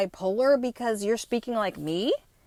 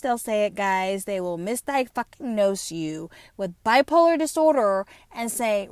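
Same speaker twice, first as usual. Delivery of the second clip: ordinary talk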